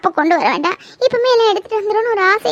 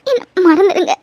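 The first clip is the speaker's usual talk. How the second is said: crying plea; 5 dB louder, slower than usual. Acted. Tearful breakup plea.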